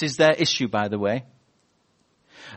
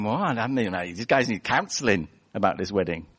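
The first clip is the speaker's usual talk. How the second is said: 1 dry skeptical aside; 5 semitones lower than usual. Pretend. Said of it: "I'd have been thinking".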